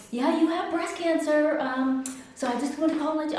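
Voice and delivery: high, sing-out doctor voice; chipper bad news. Doctor tells patient her diagnosis by phone, chipper. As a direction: deliver it cheerily, pitched high. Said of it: "she sounded so chipper".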